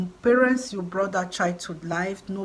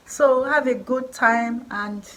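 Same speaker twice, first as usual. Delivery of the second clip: ordinary delivery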